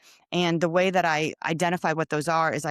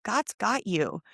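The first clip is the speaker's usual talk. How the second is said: higher, tender reassurance from the stranger; lightly voiced kind stranger saying don't stress; stranger's comfort; 5 semitones higher, much slower than usual. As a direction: gently comfort her like a kind old friend. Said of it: "and he goes".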